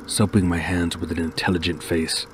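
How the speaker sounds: ordinary delivery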